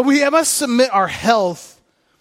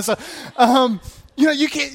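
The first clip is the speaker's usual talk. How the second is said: ordinary talk, nothing marked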